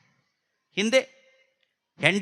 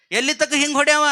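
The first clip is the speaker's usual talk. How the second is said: protesting shout; 5 semitones higher, 4 dB louder, faster than usual. Acted. Elder brother shouting in protest.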